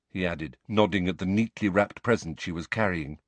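ordinary delivery

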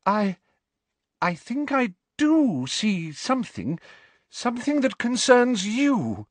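high, slow, mystical prophecy voice; ethereal voice shaking with anger. A narrator's reading. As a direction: intone a prophecy through anger. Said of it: "attempt at her usual ethereal voice ... shaking with anger".

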